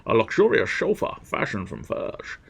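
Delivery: slurred Scottish impression with shushed s; hammed-up Scottish film star; Sean Connery. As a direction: turn every s into sh, Scottish style. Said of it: "Sorry, Sean Connery popped in".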